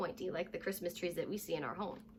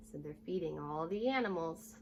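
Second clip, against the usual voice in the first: normal talking voice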